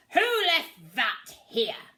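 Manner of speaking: loud, slow gruff pirate captain; growling captain. A narrator's reading. Captain stepping in a bucket, slow loud growl. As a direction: growl it out, annoyed. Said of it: "growled the captain".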